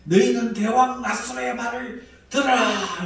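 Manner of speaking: high, loud herald's call to the people; proclaiming loudly. Acted. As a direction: call out over the city walls. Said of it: "소리 질러 불러 이르되".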